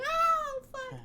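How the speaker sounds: sudden very high, loud gasp of a curse; a fan gasping at the Bro Kick; shocked yelp. Retold or imitated. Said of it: "you're like".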